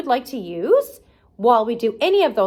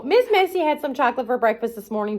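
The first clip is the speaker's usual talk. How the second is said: plain reading voice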